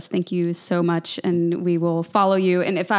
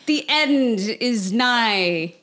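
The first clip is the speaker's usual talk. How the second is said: put-on slow, hammy theatrical British accent; mock British stage accent; 4 semitones higher, much slower than usual. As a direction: intone it like an old stage actor taking a bow. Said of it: "I don't know why I have to have an accent".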